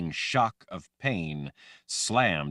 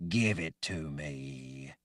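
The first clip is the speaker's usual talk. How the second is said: lower, slow, soft command; cold command; 4 semitones lower, 5 dB quieter, slower than usual. A narrator's reading. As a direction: demand it in a borrowed voice. Armina in Jagang's voice.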